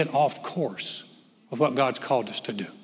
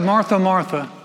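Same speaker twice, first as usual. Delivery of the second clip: a firm double call that means trouble; Jesus calling Martha twice, louder; louder, higher calling; 5 semitones higher, 6 dB louder than usual. Retold or imitated. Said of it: "He said".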